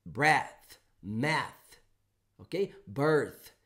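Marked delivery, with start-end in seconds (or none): 0.0-1.8 "Breath" ending on a clear unvoiced TH; unvoiced th demo. Shown as a demonstration. Teacher models the final TH sound. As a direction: close "Breath" on the tongue between the teeth. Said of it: "Você para".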